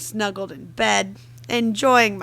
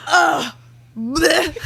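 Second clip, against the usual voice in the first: high, loud groan of disgust; exaggerated gagging "ugh, blech"; 7 semitones higher, 4 dB louder than usual. Real, not acted. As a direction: groan as if grossed out by mush. Disgusted at a romantic quote.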